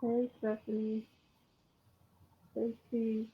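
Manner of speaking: ordinary delivery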